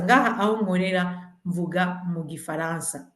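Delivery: ordinary talk, nothing marked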